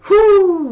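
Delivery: loud, high whoosh of relief; relieved whew. Real, not acted. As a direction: blow out a big relieved breath. Card fell on the floor.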